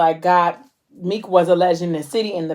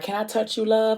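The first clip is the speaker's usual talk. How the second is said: soft, slightly raised gentle question; tender request; 4 semitones higher, 6 dB quieter than usual. Retold or imitated. Man moving close to Mo, tenderly asking. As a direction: ask softly and carefully. He tenderly asks to touch her.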